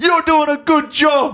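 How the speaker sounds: high, loud encouraging yell from the stands; mock cheering shout. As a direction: cheer the player on loudly. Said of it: "someone just gets up and goes".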